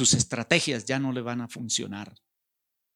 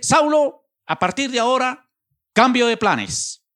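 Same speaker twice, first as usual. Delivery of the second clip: much higher and louder, a blaring order; forceful shout; 13 semitones higher, 9 dB louder than usual. Acted. The Lord telling Saul his plans change, a high shout. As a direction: announce the change of plans like a new boss.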